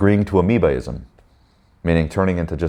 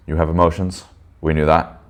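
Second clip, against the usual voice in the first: ordinary talk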